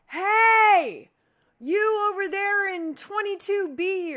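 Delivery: high, loud call across a courtyard. Retold or imitated. Streamer calling to a neighbor across the courtyard, loud holler. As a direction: holler over to the neighbor's balcony. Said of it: "screaming across the courtyard at each other".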